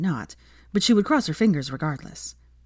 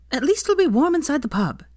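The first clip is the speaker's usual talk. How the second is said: put-on high, brash and cheeky young woman; Veronica voice; 10 semitones higher than usual.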